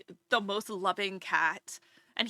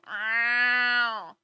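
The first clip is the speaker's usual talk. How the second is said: a lightly voiced scratchy old cat meow; mock elderly cat meow, louder; old lady cat meow; 6 dB louder than usual. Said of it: "old lady cat voices".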